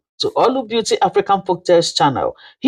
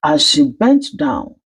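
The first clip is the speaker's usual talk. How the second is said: plain reading voice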